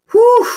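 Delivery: loud high whew of relief; relieved whew. Real, not acted. Tarot reader blowing out relief after finishing a reading, loud whew. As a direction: blow out a big relieved whew.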